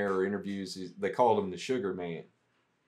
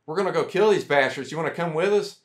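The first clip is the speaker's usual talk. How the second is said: louder, higher mock tough-guy hail; hammed-up macho shout of an armed local; gruff insurgent call; 7 semitones higher, 6 dB louder than usual. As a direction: hail him like a rowdy fighter rounding up buddies. Retold insurgents inviting him along.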